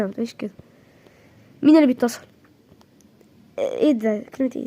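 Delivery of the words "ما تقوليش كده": drops to a near hush on the repeat; tender, much softer reassurance; soft murmur. Acted. Reassuring her, the repeat much softer.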